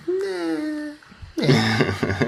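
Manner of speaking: very high, scoffing refusal; dismissive high voice. Retold or imitated. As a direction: brush the request off with a squeaky no.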